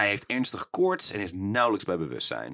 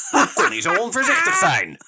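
much higher, louder boss voice; furious boss; 13 semitones higher, 7 dB louder than usual. Acted. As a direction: scold him like a ranting boss. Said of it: "bezig met zijn tirade".

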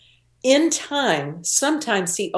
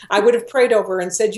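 normal talking voice

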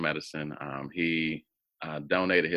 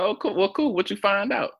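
surprised eagerness. Retold or imitated. Half brother reveals DNA result.

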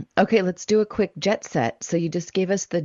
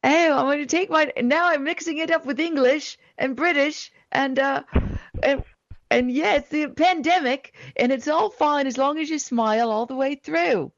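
put-on high, wobbly New Zealand accent slipping into British; 8 semitones higher than usual. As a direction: cheerily attempt a New Zealand accent, mixed with English. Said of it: "can you say that with the accent".

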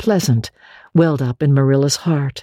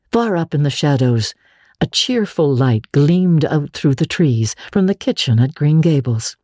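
ordinary delivery

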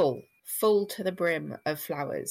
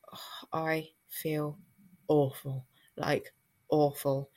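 retold feeling poorly; 2 semitones lower, slower than usual. Woman recalls thinking to herself while ill at home, poorly. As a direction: drag the words out, feeling awful.

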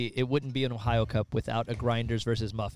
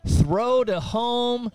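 high, loud, slow call; excited call; 11 semitones higher, 6 dB louder, much slower than usual. Real, not acted. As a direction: sell the throw home.

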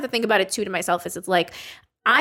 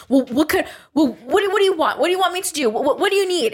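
higher, stumbling run of questions; imitated flustered pleading; 7 semitones higher than usual. Imitating her boyfriend during a fight, higher and flustered. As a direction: play a flustered partner begging for instructions.

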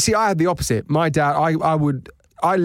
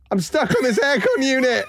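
giggling through the words, pitch up high; can barely get it out for laughing; laughing helplessly at the thought of him stuck on an aircon; 10 semitones higher than usual. Real, not acted. Laughing at free running image.

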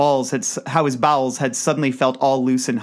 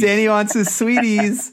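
put-on baby talk; 4 semitones higher than usual.

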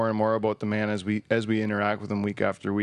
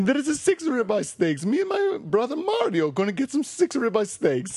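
high, excitable mock Italian; over-the-top Italian pitchman, voice way up; Italian accent; 11 semitones higher than usual. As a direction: boast in a hammed-up Italian accent. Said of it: "That was my Italian accent".

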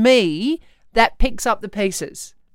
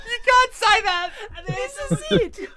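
much higher laughing protest. Real, not acted. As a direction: squeals with laughter. Wife laughs at husband.

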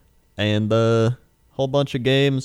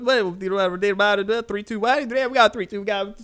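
auctioneer; 6 semitones higher, faster than usual. Pretend. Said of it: "an auctioneer".

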